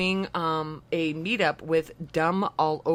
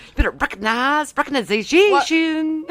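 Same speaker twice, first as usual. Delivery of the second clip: hammed-up proclamation; 9 semitones higher, 6 dB louder than usual. Host showing off her favourite wrong word, hammed-up proclamation. Mock showy demo of favourite mispronunciation.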